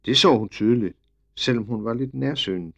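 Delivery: ordinary delivery, nothing marked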